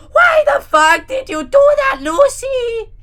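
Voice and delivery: angry yell. Acted. Gru yelling at his wife over the death threats. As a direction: yell furiously at her. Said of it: "and yelled".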